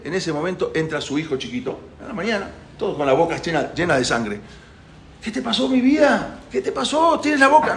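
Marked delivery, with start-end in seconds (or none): 5.2-7.8 high-pitched motherly panic; acted worried mother, voice jumping up; madre alarmada. Madre ve al hijo ensangrentado. (madre asustada, voz aguda)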